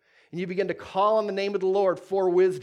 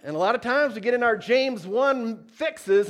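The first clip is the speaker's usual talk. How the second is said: ordinary talk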